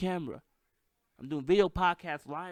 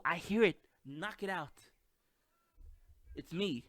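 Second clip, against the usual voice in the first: normal talking voice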